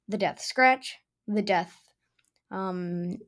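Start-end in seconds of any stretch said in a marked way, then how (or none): none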